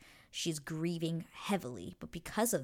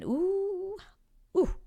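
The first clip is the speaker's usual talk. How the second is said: suggestive ooh; 7 dB louder than usual. Real, not acted. Teasing a steamy episode.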